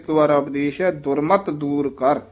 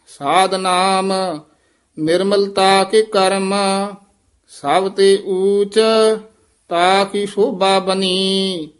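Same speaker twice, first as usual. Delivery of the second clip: measured, chanted reading of Gurbani; slow scripture recitation; 3 semitones higher, 4 dB louder, slower than usual. Acted. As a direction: recite each phrase slowly with pauses between. Teacher recites Gurbani line by line.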